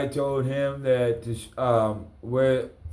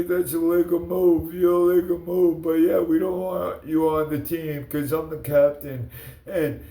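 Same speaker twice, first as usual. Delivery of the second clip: mocking whiny jock; 4 semitones higher than usual. To John Andrews, mimicking his whining about a legal move. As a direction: mimic him as a whiny, indignant bully. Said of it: "And you go".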